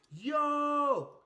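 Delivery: loud, high, drawn-out exclamation; imitated crowd outburst; mock crowd shock. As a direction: react like a stunned crowd. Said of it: "Everyone's like".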